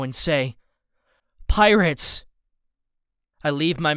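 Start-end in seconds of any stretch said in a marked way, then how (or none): 1.5-2.3 higher, louder alarmed cry; urgent shout. A narrator's reading. Boy warns his family about pirates. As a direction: blurt the warning.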